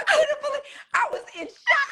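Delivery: high, loud shocked laughter. Real, not acted. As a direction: spill out disbelief. Excited retelling of shock.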